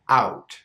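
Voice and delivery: "out" with the plain open ow; "out" with a clean, unraised diphthong; pure ow in "out"; pure sound. Shown as a demonstration. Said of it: "Pure sound".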